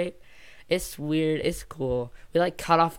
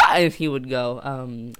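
ordinary talk

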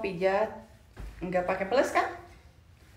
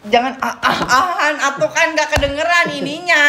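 loud laughing telling off; laughing scolding; 6 semitones higher, 9 dB louder than usual. Real, not acted. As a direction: scold him through laughter. (menegur sambil tertawa)

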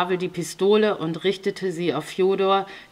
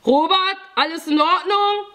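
high, loud questioning call; urgent raised check-in shout; 12 semitones higher, 5 dB louder than usual. A narrator's reading. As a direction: call from another room, ending on a rise. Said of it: "hörte ich Pavel von drin rufen".

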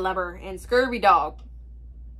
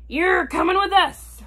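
pirate captain; 7 semitones higher, 4 dB louder than usual. Acted. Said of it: "Braidbeard told me".

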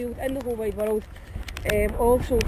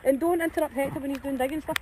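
normal talking voice

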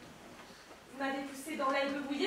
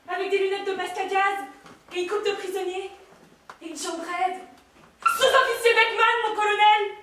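furious shout; 6 semitones higher, 11 dB louder than usual. Acted. Soldier yelling his rank and name at the colonel. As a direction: bellow it like a report on parade. Soldat announces himself.

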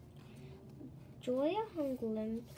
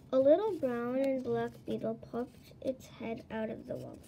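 usual speaking voice

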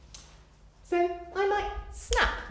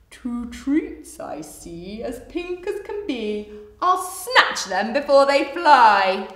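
slow crocodile voice building to loud; scheming hungry crocodile; 10 dB louder than usual. Acted. Crocodile planning to snatch flamingos, slow greedy scheming. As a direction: draw it out, then pounce loudly.

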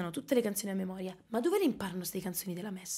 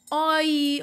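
ordinary talk, nothing marked

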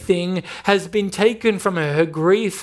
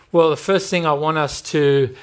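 ordinary talk

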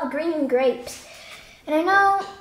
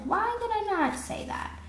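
high-pitched mock wondering; higher, exaggerated questioning of yourself; retold self-questioning; 8 semitones higher, 4 dB quieter than usual. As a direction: ask yourself in a high, put-on voice. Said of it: "or I'm like".

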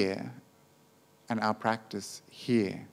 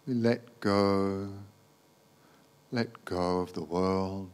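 guided meditation; 3 semitones lower, 5 dB quieter than usual. Acted. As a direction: gently guide them into letting go, unhurried.

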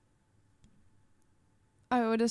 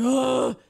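imitated grunt; 9 dB louder than usual. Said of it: "he goes like".

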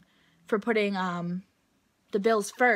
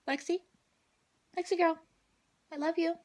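cooing to dog; 7 semitones higher, 3 dB quieter, faster than usual. Real, not acted.

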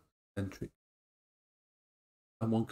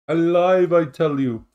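loud hammed-up cry of being alive; mock dramatic; 5 semitones higher, 11 dB louder, slower than usual. Answering the cat, a hammed-up loud cry. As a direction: ham it up like a mad scientist.

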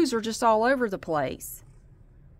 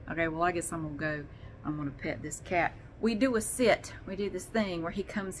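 usual speaking voice

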